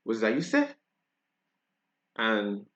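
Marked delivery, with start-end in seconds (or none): 0.0-0.7 Bajan question, rising at the end; Barbadian accent. Shown as a demonstration. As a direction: ask it in Barbados speech. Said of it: "sometimes we say in Barbados".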